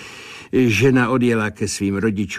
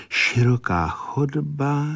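grave, slow seer's pronouncement, pitched up; slow, higher, serious clairvoyant voice; 7 semitones higher, slower than usual. A narrator's reading. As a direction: begin the vision solemnly, drawing the words out. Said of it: "Počala vážně".

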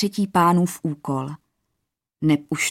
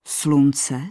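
ordinary delivery, nothing marked

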